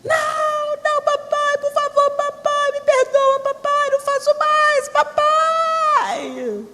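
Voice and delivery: put-on very high wailing child begging papa; dramatic child pleading. Mother imitates daughter begging father, high wailing plea. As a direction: whine like a child faking tears to escape punishment.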